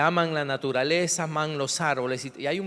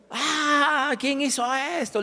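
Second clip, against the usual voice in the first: much higher, hammed-up wail; mock wailing cry; 10 semitones higher, slower than usual. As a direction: imitate mourners shrieking over a tree. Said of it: "Y gritando".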